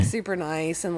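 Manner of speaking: usual speaking voice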